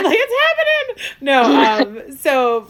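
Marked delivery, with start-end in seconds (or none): 0.0-1.1 very high, loud squeal with laughter; excited. Real, not acted. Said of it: "I'm so excited".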